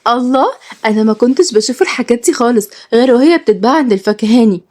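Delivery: high, joyful wonder; acted girlish delight. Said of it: "وهي بتقول بفرحة".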